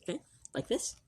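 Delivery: hushed library voice, barely above a breath; library whisper. Real, not acted. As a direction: keep it soft so nobody in the library is disturbed. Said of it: "we always use our library voices in the library".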